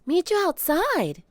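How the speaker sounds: put-on high, slow little girl call. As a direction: sing it out like a kid. Annie voiced by narrator.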